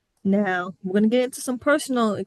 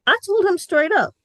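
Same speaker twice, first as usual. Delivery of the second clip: emphatic; 10 semitones higher, 6 dB louder than usual. Real, not acted. Emphatic lead into her retort.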